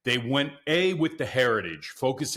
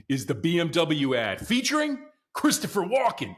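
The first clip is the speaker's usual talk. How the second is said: normal talking voice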